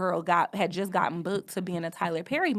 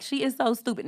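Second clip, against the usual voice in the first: higher, light teasing tone; joking; 6 semitones higher than usual. Shown as a demonstration. Showing how a harmless joke sounds, joking. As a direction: say it as playful fun. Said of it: "funny way".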